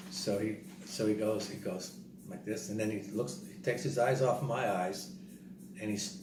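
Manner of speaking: ordinary delivery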